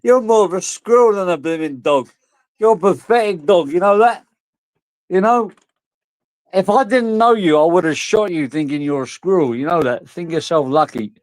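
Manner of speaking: high-pitched telling-off of a pet; mock scolding. Mock telling-off of his dog.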